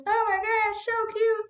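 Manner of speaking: high squealing cute voice; gushing. Real, not acted. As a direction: gush over something adorable. Gushing over baby's slide.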